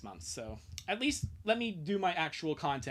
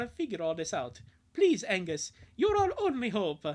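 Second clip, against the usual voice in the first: put-on higher, eager boy's voice, whoop on Wahoo; Lincoln voice; 5 semitones higher than usual. Lincoln asks old friend Angus for a big favour, high and eager. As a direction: play the boy begging a favour.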